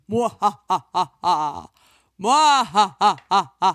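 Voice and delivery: slow, loud evil cackle; mad scientist. Acted. Halloween episode, evil laugh.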